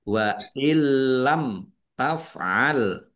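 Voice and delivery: very slow wa illam taf'al, l held; clear m before taf'al. Shown as a demonstration. Teacher recites the rule's example, m clear in illam taf'al. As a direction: illam with doubled l, then a clear m. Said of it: "dibaca jelas".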